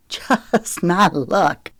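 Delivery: slow words through a dry laugh; hard laugh. A narrator's reading. Zoe caught in the rain, hard laughing. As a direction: laugh ruefully at yourself, drawn out. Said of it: "A hard laugh scratched Zoe's throat".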